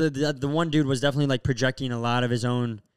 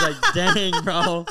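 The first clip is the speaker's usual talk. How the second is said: laughing; 7 semitones higher, 5 dB louder, much slower than usual. Real, not acted. Laughing while retelling the scene.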